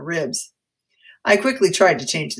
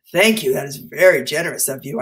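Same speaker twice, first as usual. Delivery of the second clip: plain reading voice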